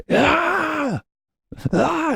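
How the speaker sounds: very high, strained yell; hammed-up guru war cry; high-pitched put-on scream; mock triumphant scream. Tony's yell after breakup.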